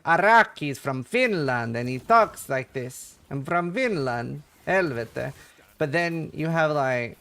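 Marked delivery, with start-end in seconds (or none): 0.0-5.3 put-on slow, heavy Finnish-accented English; mock Finnish accent. Mocking how Finns supposedly talk, thick Finnish accent. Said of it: "people like".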